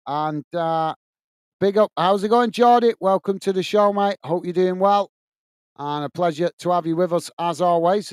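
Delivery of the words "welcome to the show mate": ordinary delivery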